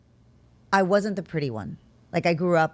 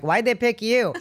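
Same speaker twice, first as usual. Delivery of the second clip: loud, higher-pitched mock scorn; teasing disbelief; 7 semitones higher, 5 dB louder than usual. Sisters ribbing the new spokesperson, teasing disbelief. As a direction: imitate sisters' incredulous jab.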